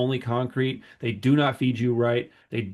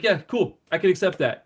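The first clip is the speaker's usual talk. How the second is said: higher-pitched, grateful acceptance; eager agreement; 6 semitones higher than usual. Retold or imitated. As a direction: agree at once, before he changes his mind. Retold eager agreement with guard.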